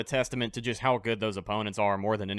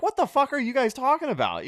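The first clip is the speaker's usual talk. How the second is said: much higher, incredulous mock fan outburst; mock outraged disbelief; 12 semitones higher, 4 dB louder than usual. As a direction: play a baffled fan scoffing at the pick. Fans react to the predictions.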